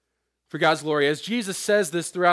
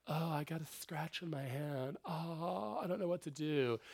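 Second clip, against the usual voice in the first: softer, whiny mock of a fragile Jesus; mocking weak Jesus; 15 dB quieter than usual. Preacher mocking a feminine Jesus, in a soft whiny voice. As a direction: play the helpless pansy for laughs. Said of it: "So Jesus wasn't like".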